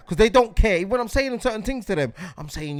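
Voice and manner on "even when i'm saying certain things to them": ordinary talk, nothing marked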